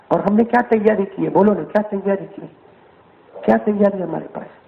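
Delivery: ordinary delivery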